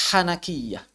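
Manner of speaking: normal talking voice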